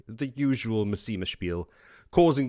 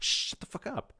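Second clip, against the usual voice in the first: hushed snap; 12 semitones higher, 6 dB quieter than usual. Retold or imitated. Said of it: "And she's like".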